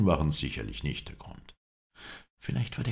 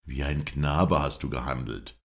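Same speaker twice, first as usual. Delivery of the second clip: ordinary delivery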